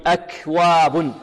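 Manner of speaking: slow أكواب with air flowing after k; drawn-out recitation, aspirated kaf; breath on the kaf. Shown as a demonstration. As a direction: let breath escape on the k of أكواب. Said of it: "والهمس جريان النفس".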